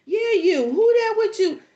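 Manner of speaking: high, loud mock holler; calling out. Mom hollering at the boy to name the girl. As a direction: holler up at him, pointed.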